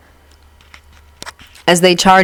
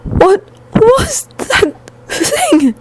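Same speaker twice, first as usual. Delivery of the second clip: high, slow, tearful fright; faint frightened question; much slower than usual. A narrator's reading. Shocked student asking about the monster, faint and frightened. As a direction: falter through it in a tiny scared voice. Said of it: "he responded in barely a whisper".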